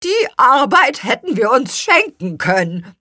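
high, loud grumbling complaint; indignant, high-pitched griping; scolding. A narrator's reading. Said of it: "schimpfte Spargelzahn".

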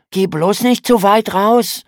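lauter, höherer besorgter Zuruf; besorgt rufend. A narrator's reading. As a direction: warnend und besorgt rufen. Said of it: "rief Lukas besorgt". (Bruder warnt die Schwester besorgt, lauter rufend)

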